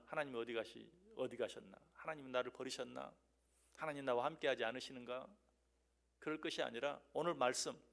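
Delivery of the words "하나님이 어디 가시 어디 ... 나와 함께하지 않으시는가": low, soft self-questioning; quiet doubting. Acted. Believer in trouble asking where God went, quiet doubt. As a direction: wonder it under your voice, uncertainly.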